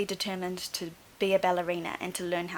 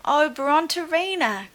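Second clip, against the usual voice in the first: higher, louder slowed lament; dismayed cry; 8 semitones higher, 6 dB louder than usual. Acted. Teacher regretfully telling the dinosaur she's too big, dismayed cry. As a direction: cry out her name in dismay. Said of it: "cried Madame Lucille".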